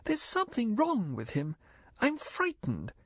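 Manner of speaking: high, hushed fearful plea; frightened. A narrator's reading. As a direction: confide her fear, trembling. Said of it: "she said desperately".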